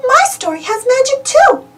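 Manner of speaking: high, bright little mouse voice; sweet high-pitched Minnie; Minnie's voice. Acted. Minnie suggesting a story to friends, high mouse voice. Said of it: "says Minnie".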